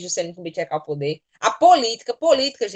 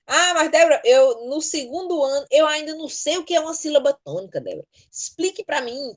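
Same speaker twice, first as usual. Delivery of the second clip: higher-pitched student complaint; pleading pupil; 7 semitones higher, 3 dB louder than usual. Acted. Second-year pupil asking the teacher to explain, higher-pitched plea. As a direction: plead as a confused pupil would.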